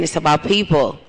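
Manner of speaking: usual speaking voice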